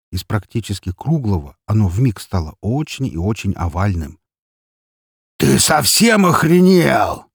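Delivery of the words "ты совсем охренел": loud, high, drawn-out indignant shout; outraged disbelief. A narrator's reading. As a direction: explode at an outrageous demand. Boss outraged by the demand.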